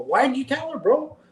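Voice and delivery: high-pitched mock disbelief; retold incredulous demand. Retold confrontation with friend.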